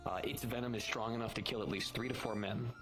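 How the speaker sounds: ordinary talk, nothing marked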